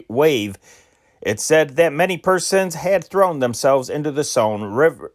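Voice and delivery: ordinary talk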